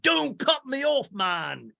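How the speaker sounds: put-on high, slow botched Italian accent; hammed-up, wobbly attempt at an Italian accent; bad Italian accent. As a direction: badly imitate a mock-Italian complaint. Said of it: "he does an Italian accent".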